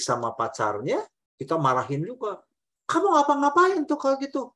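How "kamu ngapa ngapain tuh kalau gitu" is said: loud, high scolding question; scolding parent. Retold or imitated. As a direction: scold the child sharply. Parent scolding upset child.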